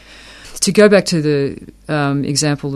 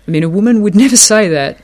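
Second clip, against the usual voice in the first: laughing; 6 semitones higher, 6 dB louder, faster than usual. Real, not acted.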